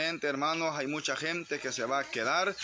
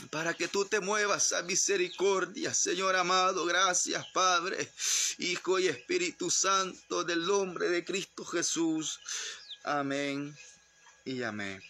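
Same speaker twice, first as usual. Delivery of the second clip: prayer winding down; 4 semitones higher than usual. Real, not acted. Brings the prayer to a close.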